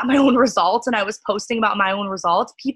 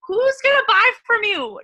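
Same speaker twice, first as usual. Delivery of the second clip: excited rhetorical question; 13 semitones higher, 3 dB louder than usual. Real, not acted. Rhetorical punchline after mock.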